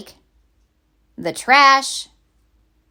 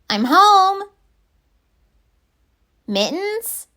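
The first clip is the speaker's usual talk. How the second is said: put-on child voice calling; 10 semitones higher, 3 dB louder, slower than usual. Penelope coming home looking for Mittens, high child call. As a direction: call out, then wonder where he went.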